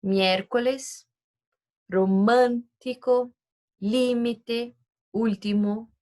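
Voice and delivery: Miércoles and romântico said slowly with a stressed first syllable; teacher reading the stress examples aloud. Shown as a demonstration. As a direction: read the example words clearly. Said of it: "exemplos de esdrúxulas".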